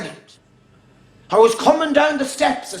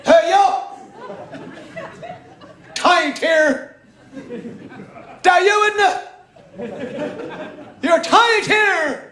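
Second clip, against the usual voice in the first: mock Traveller accent; 6 semitones higher than usual. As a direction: ham up a Traveller's accusation, pitched up. Travellers calling him out, mimicked.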